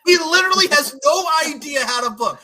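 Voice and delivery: loud, high frustrated rant; real exasperated rant. Real, not acted. Fan ranting about bad booking, loud and fed up. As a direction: vent at the booker, fed up. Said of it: "I've never seen [name] this pissed".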